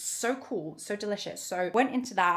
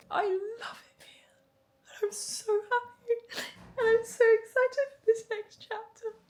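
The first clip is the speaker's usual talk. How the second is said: happy tears; 11 semitones higher than usual. Real, not acted. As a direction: voice breaking into whispers through tears. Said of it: "I feel like I'm getting emotional".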